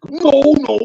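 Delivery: worried Macho asking; high alarmed Macho voice; Macho Man impression.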